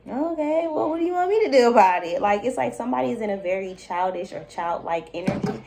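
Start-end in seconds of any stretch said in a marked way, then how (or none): 0.1-2.2 exasperated parent. Retold or imitated. Parent answering whining child.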